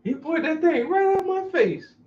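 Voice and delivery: very high, slow whine; sister crying. Retold or imitated.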